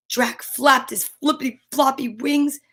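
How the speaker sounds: ordinary delivery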